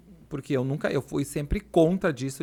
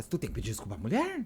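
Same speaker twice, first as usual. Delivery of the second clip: low, slightly softer quote of the wife; lightly voiced wife; 5 semitones lower, 4 dB quieter, faster than usual. Wife asking the speaker whether an apology is due, lightly imitated. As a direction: imitate the wife's low-pitched questioning. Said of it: "e dela".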